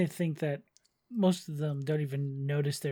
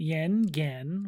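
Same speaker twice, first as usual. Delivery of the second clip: normal talking voice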